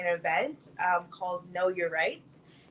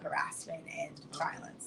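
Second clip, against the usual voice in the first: soft unvoiced whisper on the harassment question; 4 semitones lower, 8 dB quieter than usual. Real, not acted. Daughter lowers her voice to a whisper about harassment. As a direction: drop to a hush for the hard part.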